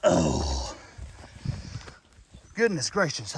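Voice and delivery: breathless after carrying heavy turtle; winded, panting between words; out of breath. Real, not acted. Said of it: "I'm out of breath".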